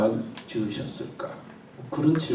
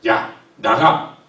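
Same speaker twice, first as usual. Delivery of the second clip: louder, slow stern command; disappointed anger; 9 dB louder, much slower than usual. Shown as a demonstration. God expelling disobedient humans, stern louder order. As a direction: throw them out with a heavy voice. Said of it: "너무 실망했다고".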